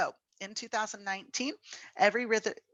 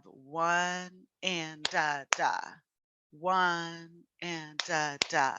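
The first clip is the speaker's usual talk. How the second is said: slow counting; much slower than usual. Shown as a demonstration. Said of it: "if we really break this down".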